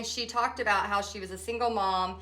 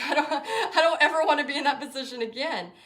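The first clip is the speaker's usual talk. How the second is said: laughing; 5 semitones higher than usual. Real, not acted. Laughing about going bankrupt.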